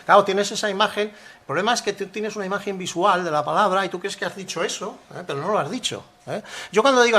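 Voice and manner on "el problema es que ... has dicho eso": ordinary delivery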